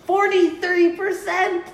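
very high, loud, drawn-out disbelief; incredulous exclamation. Acted. Host repeats a red zone stat, loudly incredulous. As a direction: shoot way up high and slow it down, astonished.

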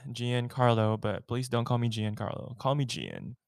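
ordinary talk

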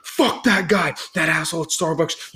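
blowing up at the barista over caramel; losing it. Retold or imitated. Retelling his outburst to his girlfriend, heated and high. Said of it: "I just lost it".

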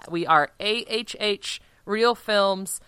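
ordinary talk

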